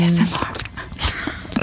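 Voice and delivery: slow whispered ASMR. Pretend. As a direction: breathe it out like ASMR. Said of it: "mock ASMR whisper, very slow".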